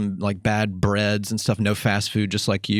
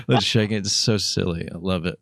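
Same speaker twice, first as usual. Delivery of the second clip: normal talking voice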